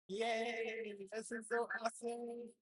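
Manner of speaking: ordinary talk, nothing marked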